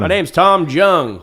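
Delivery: put-on high, loud, drawn-out Chinese accent; mock Chinese accent. Friend joins bit as Tom Jung, loud mock Chinese accent. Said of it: "Do the accent".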